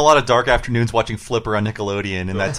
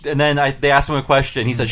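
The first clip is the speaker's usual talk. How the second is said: ordinary talk, nothing marked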